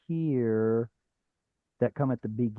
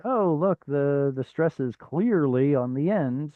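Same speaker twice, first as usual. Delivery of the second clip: naive student's discovery; slower than usual. Pretend. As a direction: play someone spotting the obvious answer. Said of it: "you'll be like".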